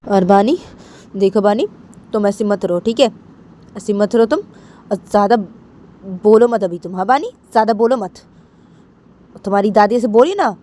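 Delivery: uncle firmly warning and scolding; lightly voiced stern uncle, emphatic orders. (सख़्ती से समझाते चाचा)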